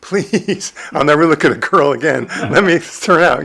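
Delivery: mock plea to God. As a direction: bargain with God like a desperate young man, half joking. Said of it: "I'm cutting deals with God".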